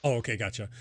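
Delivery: normal talking voice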